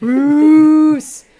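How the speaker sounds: calling Bruce, loud and high; mock shout. Mocking a shout of Bruce's name to the host. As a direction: belt out the name like calling across a room.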